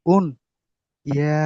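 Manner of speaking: ordinary delivery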